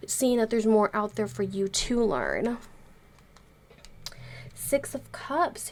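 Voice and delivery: usual speaking voice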